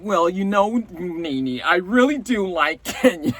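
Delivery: half-hearted, hammed-up New York accent; bad New York accent. [name] telling a friend she likes Kenya, bad New York accent. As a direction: put on a rough imitation New York accent. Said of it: "That's my really bad New York a-, accent".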